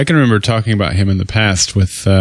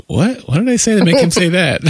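confused listener; 8 semitones higher than usual. Retold or imitated. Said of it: "they're like".

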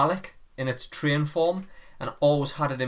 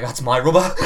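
laughing; 8 dB louder than usual. Retold or imitated. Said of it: "And you're like".

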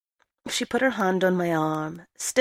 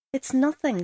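a slightly higher voice, words catching; choking over words; 7 semitones higher than usual. A narrator's reading. Girl in the rain answering the stranger, choking up a little. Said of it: "choking over her words".

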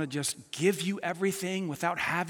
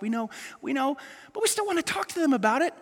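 ordinary talk

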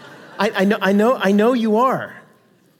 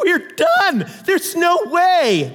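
mock dismay; 8 semitones higher, much slower than usual. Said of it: "They were like".

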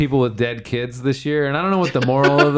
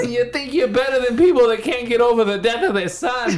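put-on Boston accent; 7 semitones higher than usual. Boston accent impression bit.

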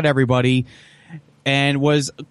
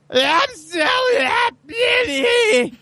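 high, slow, crying squeal of joy; man doing a woman's voice; much slower than usual. Retold or imitated. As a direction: squeal it through happy tears. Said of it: "That's a very good impression of my girlfriend".

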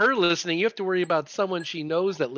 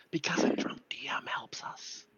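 whispering; 13 dB quieter than usual. Pretend. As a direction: whisper it like a secret plot. Conspiratorial aside about the DM.

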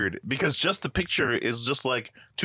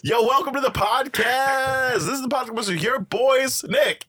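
hype announcer; 8 semitones higher than usual. Acted. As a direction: pump up the crowd. Hyped podcast intro.

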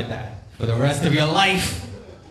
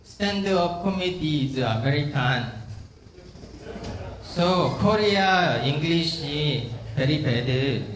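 put-on terrible Korean accent; 3 dB quieter than usual. Comic greeting an American crowd, hammed-up Korean accent. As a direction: put on a heavy Korean accent with careful pacing. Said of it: "I gave you my terrible Korean accent".

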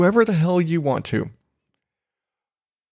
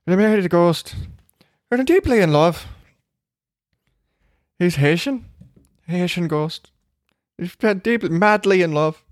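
hazy, put-on Irish accent, lifted in pitch; mock a lovestruck Irish bride; 3 semitones higher than usual. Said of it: "my accent's a little hazy there".

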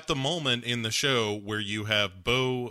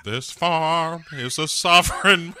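higher, louder country proclamation; hammed-up defiant old-timer; Uncle Jesse; 5 semitones higher, 6 dB louder than usual. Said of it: "And Uncle Jesse says".

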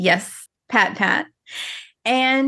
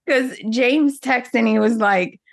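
ordinary talk

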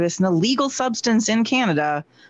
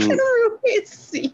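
laughing; much slower than usual. Real, not acted. Laughing repeating the crude word.

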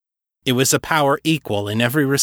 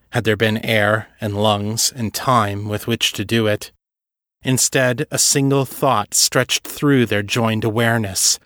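usual speaking voice

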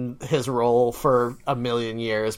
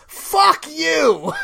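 yelled, much higher and louder; angry shout; 12 semitones higher, 3 dB louder than usual. Acted. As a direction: shout it in mock fury. Angry rant at Coca-Cola.

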